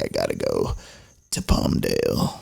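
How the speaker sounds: quiet, very low creaky voice; hungover excuse. Retold or imitated. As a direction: drag it out in a faint croak. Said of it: "still hungover at, like, noon".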